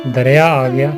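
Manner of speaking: plain reading voice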